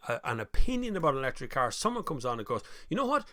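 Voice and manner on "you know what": high mocking know-it-all voice. Commenter correcting the host, mock smug voice.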